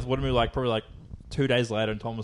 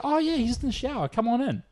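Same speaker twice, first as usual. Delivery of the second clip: put-on high, hospitable old-lady voice; 11 semitones higher than usual. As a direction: imitate a welcoming old lady, high-pitched. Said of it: "old multi-lady answers".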